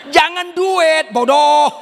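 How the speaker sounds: high, loud, slow mock rebuke; playful loud scolding; scolding shout. Real, not acted. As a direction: drag it out then snap the last word. Preacher scolding the congregation.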